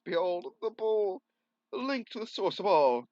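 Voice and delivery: high, loud solemn reveal; grand proclamation. Acted. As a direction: proclaim it like a king.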